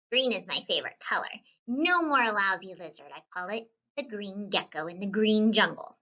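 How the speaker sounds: usual speaking voice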